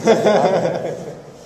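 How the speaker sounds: big hearty laugh; loud laugh. Real, not acted. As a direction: burst out laughing. Joking about seven bananas.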